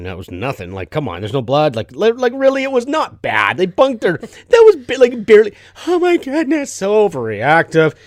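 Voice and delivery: mock rant building high and loud; hammed-up exasperation at an overreaction; exasperated dismissal. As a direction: start scoffing, climb to a loud, high protest. Acting out dismissive inner rant.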